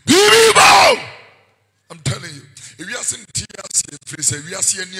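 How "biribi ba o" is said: shout. Real, not acted. Preacher cries out warning.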